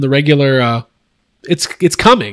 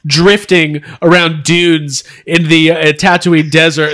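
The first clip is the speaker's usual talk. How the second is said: normal talking voice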